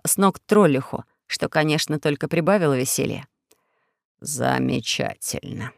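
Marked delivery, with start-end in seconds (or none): 4.2-5.7 low, slow, hushed demagogue; Four-Eyes. Acted. Dictator accusing the prisoners, low slow speech. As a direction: drone the accusations like a sly dictator. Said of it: "сказал Четырёхглазый".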